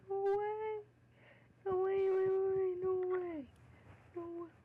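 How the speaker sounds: high disbelieving squeals; squealing no way over and over; shrieking jackpot winner. Real, not acted. Big in-game reward reveal.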